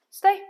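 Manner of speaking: high, loud firm command; firm stay. Shown as a demonstration. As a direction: say stay firmly to the dog. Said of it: "in a firm tone of voice".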